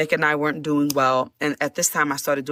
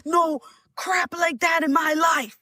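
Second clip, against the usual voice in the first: friend freaking out; 10 semitones higher than usual. Retold or imitated. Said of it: "my friend said".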